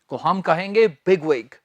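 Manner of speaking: ordinary delivery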